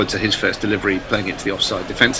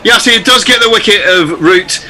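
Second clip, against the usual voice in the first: excited commentary; 12 semitones higher, 7 dB louder than usual. Real, not acted. Commentator calls a wicket live.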